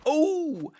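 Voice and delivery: very high, loud startled yelp. Acted. Piglet flying up in Kanga's pocket, high yelp. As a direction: yelp it as you fly up. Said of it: "he said".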